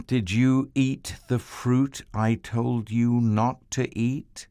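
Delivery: slow, searching question; God questioning his children about the fruit. Acted. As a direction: ask slowly, already knowing. God questions Adam and Eve.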